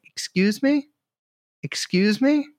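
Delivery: a hint of a clueless guy asking to pay; oblivious polite customer. Customer ignores the weeping shop owner, mildly mock-polite.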